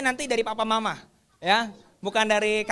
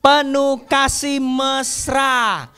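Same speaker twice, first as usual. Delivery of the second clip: put-on slow, loud, drawn-out verse chant for kids; slow recitation; 6 dB louder, much slower than usual. Teacher leading children through a memory verse, slow and loud. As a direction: lead the class word by word.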